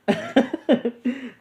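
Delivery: high burst of real laughter; bright real laugh. Real, not acted. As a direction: crack up high. Laughs at Nami joke.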